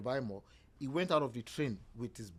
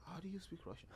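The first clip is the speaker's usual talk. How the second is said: whispered "How do you speak Russian?" in disbelief; amazed whisper; 5 semitones higher, 14 dB quieter than usual. Retold or imitated. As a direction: breathe the question in awe. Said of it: "She was like".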